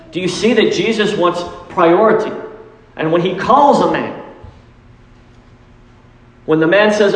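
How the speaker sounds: ordinary talk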